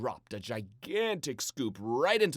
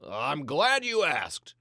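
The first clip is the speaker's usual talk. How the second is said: louder, slow, showman style; Papa's voice; 3 semitones higher, 10 dB louder, slower than usual. A narrator's reading. Papa teasing the family about his plan, louder and slow. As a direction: savour the reveal.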